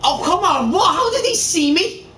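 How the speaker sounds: high, slowed-down outraged disbelief; panicking gamer. Real, not acted. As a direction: wail it in frustrated disbelief. Spotted by enemy in game.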